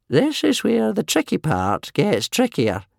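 slow, high wheezing elder; wheezy old man. A narrator's reading. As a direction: wheeze it out slowly, pitched high. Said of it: "wheezed old Wrinkly".

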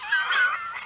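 very high shrieking burst of laughter. A narrator's reading. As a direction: cackle at his mistake. Skippy mocks Joe's ignorance.

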